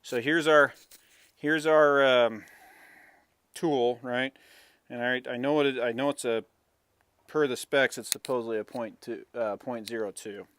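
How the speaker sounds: talking around a flashlight held in teeth; flashlight in mouth. Real, not acted. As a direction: mouth propped open by a flashlight. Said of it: "I'm putting my flashlight in my mouth".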